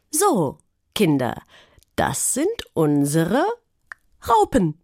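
a lightly voiced teacher presenting the box; playful teacher reveal. A narrator's reading. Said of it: "sagte sie".

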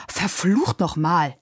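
slow, drawn-out curse; understated yell of frustration; angry cursing. A narrator's reading. Coachman curses as the horse shies, angry. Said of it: "Der Kutscher schrie jetzt".

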